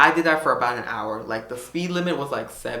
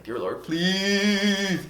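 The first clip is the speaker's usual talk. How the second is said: slow, higher mock-desperate plea; pleading prayer; 7 semitones higher, much slower than usual. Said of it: "like, praying".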